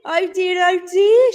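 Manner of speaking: high, sing-song worried lament, not muttered; a lightly voiced fretting bear; muttering. A narrator's reading. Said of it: "she muttered to herself".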